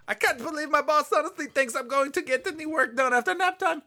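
very high-pitched, silly cartoon delivery; put-on cartoon voice. Player performing the card line, high cartoon voice. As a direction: squeal the line like a cartoon character.

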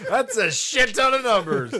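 loud, high, cracking up; helpless amused outburst; laughing exclamation. Real, not acted. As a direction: blurt it out through laughter. Cracking up at number joke.

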